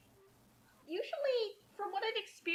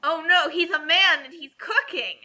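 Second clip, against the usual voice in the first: mock outrage; 8 dB louder than usual.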